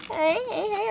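ordinary delivery

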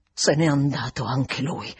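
low-pitched, held-back disclosure; reluctant final admission. A narrator's reading. Housekeeper finally answering governess, low reluctant admission. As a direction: let it out at last, pitched low. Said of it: "sbottò alla fine".

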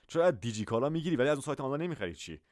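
normal talking voice

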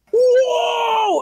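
high, drawn-out "whoa"; long high-pitched mock holler; mock crowd cry. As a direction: imitate a whole crowd reacting. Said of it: "and then everyone goes".

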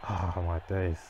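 normal talking voice

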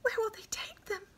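quick, high, soft whisper; hushed childlike whisper; whispered question. A narrator's reading. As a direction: whisper it urgently, like a small boy. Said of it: "whispered Sabi".

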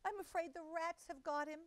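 high, tearful fretting mother-cat voice. A narrator's reading. Tabitha greets her cousin in tears, high and tearful. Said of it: "shedding tears".

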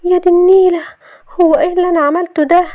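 high, loud mortified outburst; loud dismay. A narrator's reading. Shahd realising she hugged Youssef, loud and flustered. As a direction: exclaim in horror at yourself, pitch jumping. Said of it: "قالت بصوت عالي".

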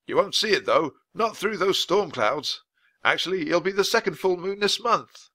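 a hint of a proper old-fashioned gentleman; polite official explaining; lightly voiced weather man explains the castle to children. Voice of Mister Perkins.

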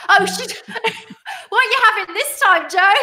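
high, loud playful teasing; high, loud mock surprise at a friend's drink order; excited teasing. Real, not acted. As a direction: tease her about the drink, pitched high. Teasing co-host about her drink.

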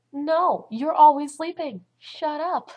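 loud, high-pitched acted snap back; angry retort. Student snapping back at the sleepy classmate, angry. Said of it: "I was just so angry".

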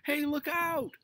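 high, loud alarmed cry; warning shout. A narrator's reading. Robert as a car nears the dog, warning shout. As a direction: shout a sudden danger warning. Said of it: "warned Robert".

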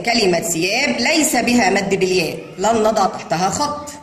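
normal talking voice

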